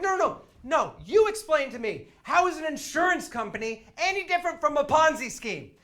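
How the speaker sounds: loud, high-pitched indignant rant; angry confrontation. Retold or imitated. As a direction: demand answers like an outraged customer. Acted furious argument with insurance agent.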